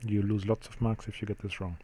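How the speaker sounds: usual speaking voice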